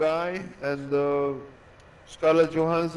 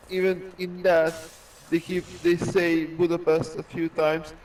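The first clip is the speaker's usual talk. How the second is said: usual speaking voice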